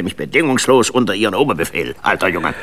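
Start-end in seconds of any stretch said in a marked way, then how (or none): none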